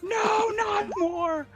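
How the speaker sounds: high, slow, loud mock despair; anguished wail. Real, not acted. GM wails at more fish.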